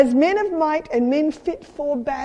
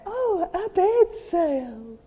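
longing sigh; 5 semitones higher, much slower than usual. Retold or imitated. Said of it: "I'd say".

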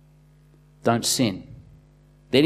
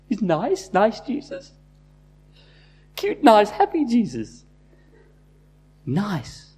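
mocking sweetness; 7 semitones higher, slower than usual. Mocking "nice Jesus" idea.

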